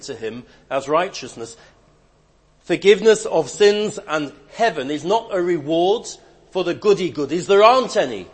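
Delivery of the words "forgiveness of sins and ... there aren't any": louder, higher pulpit emphasis; fervent pulpit declaration; preaching surge. Acted. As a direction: punch it to the congregation.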